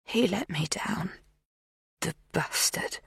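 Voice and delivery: soft, voiceless, slow bitterness; bitter whisper. A narrator's reading. Magda remembering the man who left her, bitter whisper. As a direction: hiss the memory under her breath.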